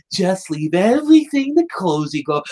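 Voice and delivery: cartoonishly sneaky schemer; Cozy Glow. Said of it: "cartoonishly sneaking along".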